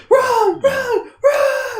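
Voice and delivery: very high, strained shriek; piercing imitation cry; hammed-up yelling. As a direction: screech it out in mock desperation. Imitating character yelling proverbs.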